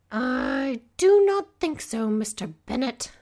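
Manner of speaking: slow, high and loud, ominous warning. A narrator's reading. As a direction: let each word threaten, slowly. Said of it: "an ominous note in her words".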